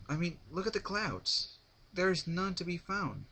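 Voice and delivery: a hint of a brash young flier. A narrator's reading. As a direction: brush off the refusal, a touch cocky. Rainbow's lines in the story.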